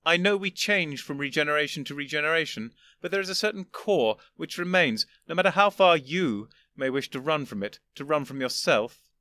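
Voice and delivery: put-on an old gentleman patiently explaining; lofty old Doctor. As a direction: superior elder explaining to his younger self. First doctor lecturing.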